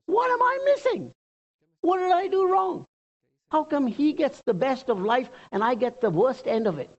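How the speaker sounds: high-pitched aggrieved whine of envy; envious complaint. Acted. Preacher voices Asaph envying the wicked, high-pitched complaint.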